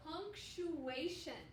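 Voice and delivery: punctuation drawn out slowly, each syllable clear. Shown as a demonstration. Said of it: "Can you say that with me?".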